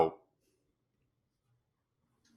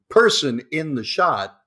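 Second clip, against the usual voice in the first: ordinary talk, nothing marked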